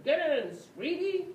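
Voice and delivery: high, loud call to get in; loud driver calling sweetie; driver shouting. Acted. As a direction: shout from the car window. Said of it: "the driver shouted".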